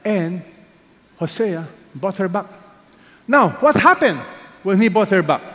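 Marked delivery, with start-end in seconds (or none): 3.3-5.4 high, loud rhetorical question; preaching fervor. Real, not acted. Preacher pressing the congregation on what Hosea did, loud and high. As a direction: build to a peak for the congregation.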